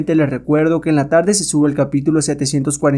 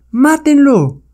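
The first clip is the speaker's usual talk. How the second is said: high, loud command to kill; shouted order; 12 semitones higher, 5 dB louder, much slower than usual. Acted. Savage woman orders her apes to attack, shouted command. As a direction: scream the order. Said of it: "la mujer salvaje ordena".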